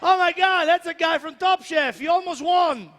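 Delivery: mock fan outburst, high and loud; starstruck fan shouting. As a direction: yell it like a fan spotting a TV contestant. Mimicking fans who recognize him from TV.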